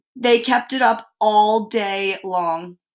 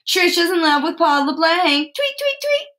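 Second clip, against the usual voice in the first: high, taunting sing-song ending in squeaky tweets; high mocking chant; teasing; 8 semitones higher, 3 dB louder than usual. A narrator's reading. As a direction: tease like a pesky kid, pitched up. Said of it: "made kissing sounds and said".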